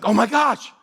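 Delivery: high, loud gasp of alarm; hammed-up alarmed discovery; mock panic. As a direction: imitate a panicking girl, pitch jumping up. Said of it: "she starts panicking".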